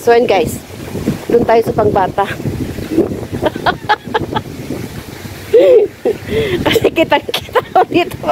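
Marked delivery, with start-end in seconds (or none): 2.3-8.3 high embarrassed giggles through words; giggle, shy of being seen; giggling. Real, not acted.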